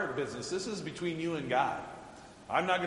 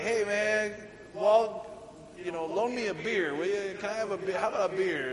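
put-on higher, pleading drunk bum asking for a beer; tipsy hobo begging; 6 semitones higher than usual. Drunk hobo begging Walt for a beer, wheedling and higher. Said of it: "he's like".

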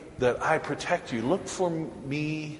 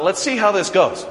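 mischievous; 7 semitones higher, 7 dB louder than usual. Retold or imitated. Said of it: "I thought".

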